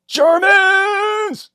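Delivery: high, loud dramatic exclamation; dramatic shout. Acted. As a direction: belt it out like a newsreel. Video host dramatic exclamation.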